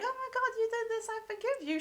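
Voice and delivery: high, quick mock gushing forgiveness. Mocking an instant forgiveness, high and quick. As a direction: gush it out fast and high. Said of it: "She doesn't just go like".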